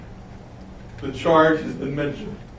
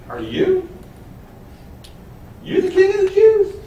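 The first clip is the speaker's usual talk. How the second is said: much higher and louder, sneering disbelief; mock him with a high, loud sneer; sarcastic Pilate; 6 dB louder than usual. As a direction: Pilate mocking the beaten prisoner, high and sarcastic. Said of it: "he's actually being sarcastic".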